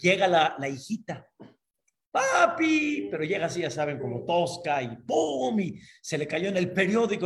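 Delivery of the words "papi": high, eager little call for papá; excited daughter calling. Acted. Daughter barging in on her resting father, excited high call. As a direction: burst in calling out, pitched up.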